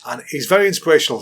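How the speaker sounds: normal talking voice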